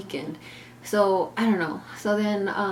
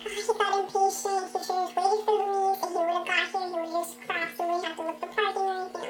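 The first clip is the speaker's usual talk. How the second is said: much higher put-on mom voice; mimicking mom; 13 semitones higher than usual. Narrator imitates her mom asking the driver, high-pitched.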